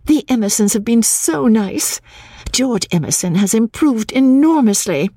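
high, radiant enthusiasm; unusual radiance. A narrator's reading. Lucy greeting Cecil because she felt safe, radiant. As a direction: gush high and happily. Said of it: "greeted Cecil with unusual radiance".

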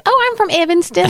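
put-on a light West Texas accent, mom telling a stranger where she lives; mom's Texas accent. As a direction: mimic mom answering in a thick Texas accent. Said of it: "She says".

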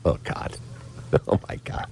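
breathless unvoiced whisper of embarrassment; mortified whisper. Caller whispers in horror after the agent overhears the insult, unvoiced whisper.

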